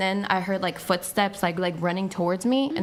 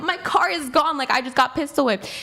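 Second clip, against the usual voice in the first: panicked; 6 semitones higher than usual. Retold or imitated. Panicked telling boyfriend about carjacking.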